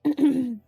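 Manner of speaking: loud clearing of the throat. Real, not acted. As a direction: clear your throat firmly. Clears throat before repeating aside.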